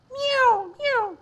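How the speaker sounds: very high mewing; kitten meows. Acted. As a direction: mew like a little kitten. Kittens meowing in poem.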